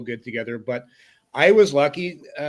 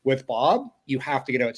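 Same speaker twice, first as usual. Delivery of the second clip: ordinary delivery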